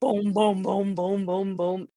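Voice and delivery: even, tuneless booming; imitated slammed keys. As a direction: thud out piano chords with no song in them. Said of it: "it's not like a song".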